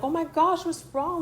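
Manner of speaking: lightly acted, high-pitched concern; mock alarmed concern. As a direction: imitate worried friends rushing to comfort. Group reacts to her crying.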